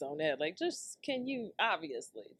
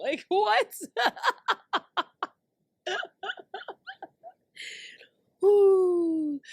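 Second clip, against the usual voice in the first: laughing; 13 semitones higher, 8 dB louder, much slower than usual. Real, not acted. Laughing at [name].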